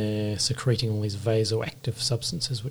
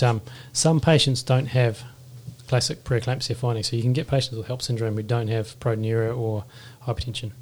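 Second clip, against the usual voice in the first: usual speaking voice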